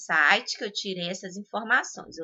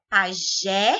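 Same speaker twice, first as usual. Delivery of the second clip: Pajé with JÉ punched harder; stress on last syllable; 4 dB louder than usual. Shown as a demonstration. Teacher giving an oxytone example, stress on JÉ in Pajé. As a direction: hit the final -jé of Pajé. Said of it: "o G é mais forte".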